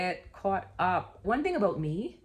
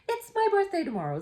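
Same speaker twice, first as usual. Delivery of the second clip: high, fast birthday squeal; excited; 13 semitones higher, faster than usual. Real, not acted. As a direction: gush with excitement.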